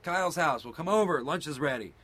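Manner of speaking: imitated mom and kid yelling; calling across yards. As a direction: shout it between backyards. Shouted exchange across backyards.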